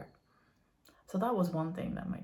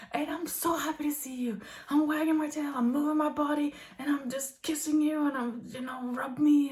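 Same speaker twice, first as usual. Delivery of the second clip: a hint of the excited dog, a bit higher; bubbly dog talk, toned down; lightly voiced happy dog; 5 semitones higher than usual. Dog greeting the family, a lighter happy voice. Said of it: "he's like".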